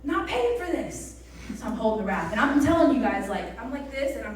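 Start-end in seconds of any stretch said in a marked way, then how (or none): 0.0-1.2 stubborn refusal. Retold or imitated. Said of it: "I was like".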